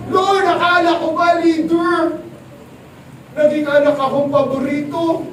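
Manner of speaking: voiced Joseph protesting his fate; complaining to God. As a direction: pour out the grievance loudly.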